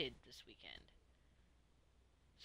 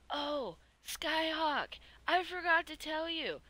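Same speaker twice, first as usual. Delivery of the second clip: sudden remembering; 10 semitones higher, 7 dB louder than usual. Real, not acted. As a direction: burst out as it hits you.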